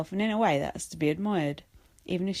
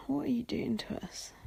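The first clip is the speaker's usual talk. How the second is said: soft whispered plea; 4 semitones higher, 8 dB quieter than usual. Real, not acted. Pleading to Big Brother in a whisper. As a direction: breathe it as a whisper. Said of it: "whispered plea".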